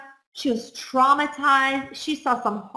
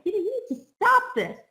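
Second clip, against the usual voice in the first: high, quick, urgent call to stop; stepping in to stop a fight, urgent and firm; firm intervening; 9 semitones higher, much faster than usual. Retold or imitated. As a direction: jump in and break it up, quick and high. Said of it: "You would be like".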